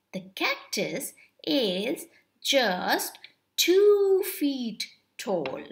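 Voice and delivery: ordinary delivery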